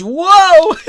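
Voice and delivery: loud high whoa then a burst of laughter; startled whoa into laughter. Real, not acted. Gamer startled by the game, then laughing. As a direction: caught off guard, then cracking up.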